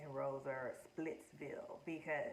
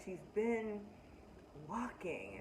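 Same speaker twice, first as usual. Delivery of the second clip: normal talking voice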